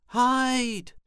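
high, loud drawn-out warning cry. A narrator's reading. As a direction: shout the warning high and long. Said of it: "she cried".

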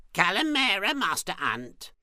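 high, loud spider greeting; spider calling out. A narrator's reading. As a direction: call back loudly in a high voice. Spider calls back to Ant.

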